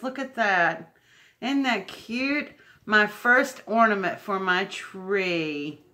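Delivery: ordinary talk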